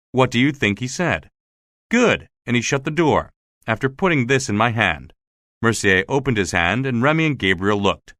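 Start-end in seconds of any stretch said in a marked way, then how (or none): 1.9-2.3 sharp, high single word; curt high good. Retold or imitated. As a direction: snap it out high. Curt dismissal.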